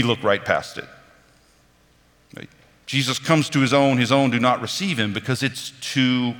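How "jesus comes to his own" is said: normal talking voice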